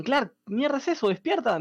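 lightly acted, high-pitched urging; retold urgent calling. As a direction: act out trying to rouse someone. Friends try waking Clark.

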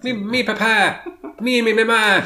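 loud, high silly babble; hammed-up mocking. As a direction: belt out mock nonsense syllables. Hammed-up mock accent, playing with words.

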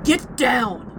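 sudden, urgent command; urgent warning shout. A narrator's reading. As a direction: shout it to save someone from attack. Warning before a lightning attack.

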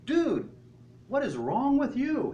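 high-pitched incredulous rebuke; mildly hammed-up disbelief; mock exasperation. Imagined rebuke Jesus does not give.

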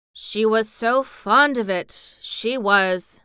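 put-on slow, loud old Yorkshireman remembering; unhurried country reminiscence; slowly. A narrator's reading. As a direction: draw it out in slow recollection. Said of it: "said Ben Weatherstaff slowly".

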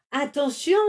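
high, loud sudden warning; warning cry. A narrator's reading. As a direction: shout to stop him. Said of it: "cria le dromadaire".

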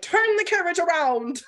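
crying out to the driver; urgent shout. Retold or imitated. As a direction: yell it like a sudden realisation. Heroine's urgent command, retold.

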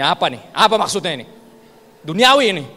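loud, outraged demand; acted indignation. Pious elder brother objects to the worldly party, loud indignant protest. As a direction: protest loudly like an offended pious man.

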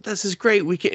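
put-on high excited girl voice; playful high delight; happy heroine. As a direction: gush it like a happy girlfriend. Heroine happy they can date.

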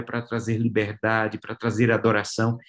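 normal talking voice

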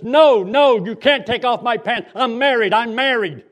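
put-on loud, high drunken protest; drunk husband yelling. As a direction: yell it like a drunk refusing.

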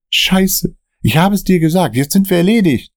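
he thinks they're done for; panicky Dan. Acted. Dan cursing at Michael during the car chase, panicked.

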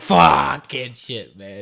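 loud, drawn-out exasperated curse that drops off at the end; long loud groan of frustration, then a mumbled man; frustrated outburst. Retold or imitated. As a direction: groan it out loud and slow, sick of sitting there. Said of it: "You're like".

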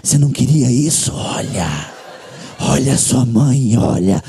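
low, slow unvoiced devilish whisper. Satan whispering temptation in his ear, low unvoiced whisper. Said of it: "Ele falou".

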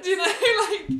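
laughing. Real, not acted. Laughing mid sentence.

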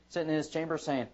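ordinary talk, nothing marked

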